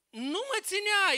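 high, loud, slow acted command; acted emphatic plea; Jesus exclaiming. Acts Jesus telling Mary to let go.

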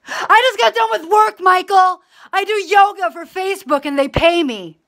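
high, loud aggrieved yelling; acted tirade. Acting out a money quarrel with Michael, aggrieved shouting. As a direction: keep ranting at full pitch, hard done by. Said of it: "This is me acting".

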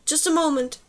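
a restrained call back asking for patience; yelled. A narrator's reading. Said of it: "she yelled".

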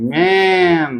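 ম্যান drawn out on an open "ae"; slow classroom model of ম্যান; jophola-akar vowel. Shown as a demonstration. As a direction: hold the middle of ম্যান very long. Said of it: "এইরকম দেখো, অ এ জফলা আকার".

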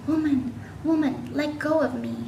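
understated cry from inside; inner cry. A narrator's reading. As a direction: plead as an inner voice, lightly acted. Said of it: "Something inside her was crying".

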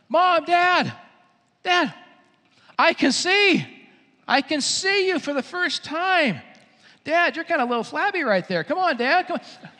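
high, excited calling out. Acted. Healed man greets his parents, excited. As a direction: burst in with thrilling news. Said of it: "He gets so excited".

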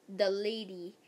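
ordinary delivery, nothing marked

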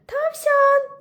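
high, loud call across the field. Acted. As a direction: shout the friend's name. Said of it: "diye seslendi".

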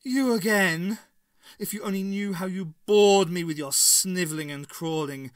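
imperious villainess disdain; scornful. A narrator's reading. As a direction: berate a tiresome underling. Villain berates her servant.

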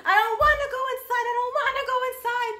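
imitating a toddler whining mid-tantrum; mimicked whining child. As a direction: whine it like a kid refusing to go in. Retold child refusing to go inside.

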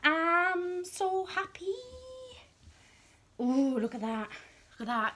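ordinary delivery

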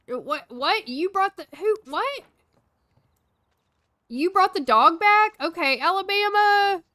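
can't believe the dog is back; confused surprise. Real, not acted. Maid brings dog back unexpectedly.

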